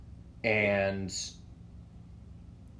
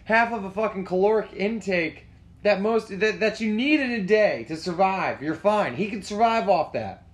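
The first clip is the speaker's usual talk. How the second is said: high, loud mock outrage; exasperated rant; 9 semitones higher, 5 dB louder than usual. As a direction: rant at the mom in loud disbelief. Retold exasperated rant at the mom.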